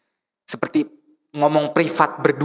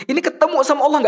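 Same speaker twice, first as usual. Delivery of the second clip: high, pressing appeal; preacher's impassioned rise; urgent; 12 semitones higher, 3 dB louder, faster than usual. Acted. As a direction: lift the voice in urgent wonder. Urging feeling in prayer.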